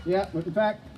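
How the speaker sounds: brassy showman working the crowd; game show host. Acted. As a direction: sell the prizes like a TV host.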